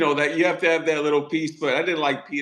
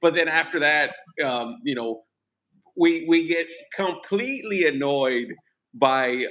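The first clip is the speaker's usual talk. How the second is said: ordinary talk, nothing marked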